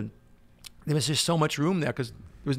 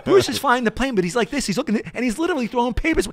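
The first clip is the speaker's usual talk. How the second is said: ordinary delivery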